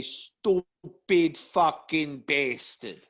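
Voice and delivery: slow, drawn-out mock Italian-American accent; fake Italian accent. Shown as a demonstration. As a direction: drag it out like a mobster. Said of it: "The only Italian thing I do".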